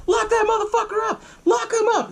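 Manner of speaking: very high, strained mock yell; acted pissed-off shouting. Officer after a scuffle demands an arrest, angrily. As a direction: imitate a furious order, pitch way up. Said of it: "getting all pissed off".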